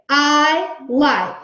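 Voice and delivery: put-on each word said slowly and clearly for young writers; slow model sentence. Shown as a demonstration. Teacher to class, saying the model sentence slowly. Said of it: "So I'm gonna say".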